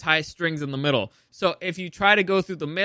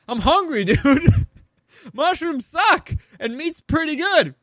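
high, slow, laughing goofy voice; lazy hungry Paul; 9 semitones higher, 4 dB louder, slower than usual. Retold or imitated. Paul shrugs off betrayal to Campion, slow goofy high voice. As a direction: laugh it off like a careless hungry kid. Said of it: "And Paul's just like".

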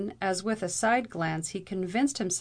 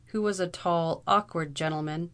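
usual speaking voice

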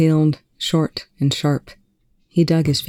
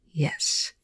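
soft, breathed reply; barely voiced murmur; 9 dB quieter than usual. A narrator's reading. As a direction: say it on a breath, much softer. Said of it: "Rush breathed".

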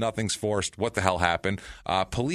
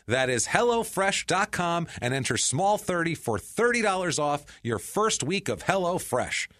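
ad read; 8 semitones higher than usual. Acted. Ad read with promo code.

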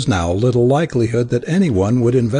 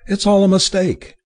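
a sharp, higher "No"; distressed cry; 8 semitones higher than usual. A narrator's reading. Dewar stops short before his arms, distressed cry. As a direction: cry it out, backing away. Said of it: "she cried".

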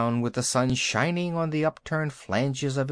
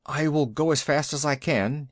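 plain reading voice

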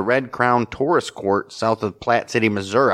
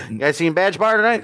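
announcer voice; 8 semitones higher than usual. Pretend. Hyping a band like announcer.

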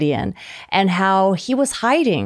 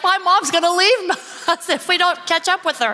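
put-on worried kids; 8 semitones higher than usual. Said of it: "'Cause they're like".

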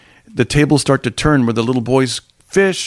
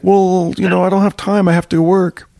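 put-on higher-pitched boy making excuses; imitated boy; 5 semitones higher than usual. Boy excusing himself to parents, mildly defensive. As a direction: protest lightly as a young boy would. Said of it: "and he's like".

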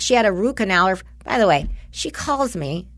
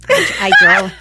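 laughing; 7 dB louder than usual. Real, not acted. Laughing retelling.

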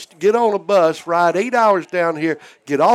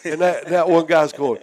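usual speaking voice

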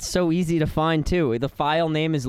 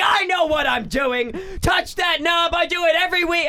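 high shouted mock insistence, much higher; mocking impression of cohost; 12 semitones higher than usual. Mocking the cohost who refused to listen, high mock shout. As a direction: ham up his stubborn boss voice, yelled. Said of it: "And you were like".

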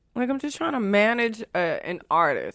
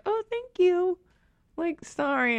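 very high, softer retold thank-you; sheepish thanks; 11 semitones higher, 6 dB quieter, slower than usual. Driver realizes he was helping, high sheepish thanks. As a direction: squeak out small, humbled thanks.

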